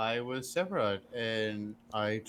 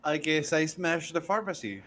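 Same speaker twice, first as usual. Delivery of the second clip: normal talking voice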